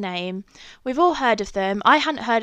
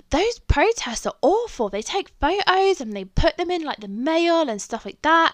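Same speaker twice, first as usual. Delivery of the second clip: higher-pitched worried mum; mum's warning; 7 semitones higher than usual. Retold or imitated. Mum warning Emma about newspaper photos, higher and worried. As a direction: play an anxious mum warning her daughter.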